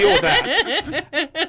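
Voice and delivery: slow, high, laughing Irish accent; hammed-up Irish accent, laughing. Shown as a demonstration. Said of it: "I'm gonna do a shitty Irish accent".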